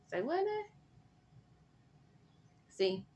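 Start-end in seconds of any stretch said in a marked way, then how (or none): 0.1-0.6 disbelief. Real, not acted. Reacting to Jackie's confession.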